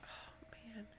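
breathy whisper. Real, not acted. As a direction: hush it under the breath. Creepy grandma singing nearby.

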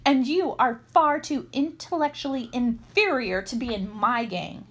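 hint of a superior villain; haughty retort. Sanj insulting his accomplice back, lightly haughty.

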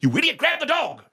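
high, strained mock yell; retold yelling. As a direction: berate like an exasperated dad yelling. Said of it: "he's yelling at you".

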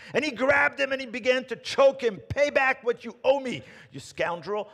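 high, loud, furious threat; angry servant. Acted. Servant choking his fellow servant over a debt, furious. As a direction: choke the words out in rage.

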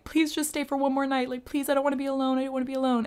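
high, rushed begging, imitating her past self; desperate pleading. To her ex as he leaves, high rushed begging. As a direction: plead like you can't bear to be alone. Begging ex to stay one night.

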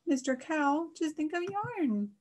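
high, slow, drawn-out cat voice; meowing cat. A narrator's reading. Miss Cat advises sad Mister Cow, meowing high and slowly. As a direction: meow the words, stretching them out. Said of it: "When Miss Cat meowed".

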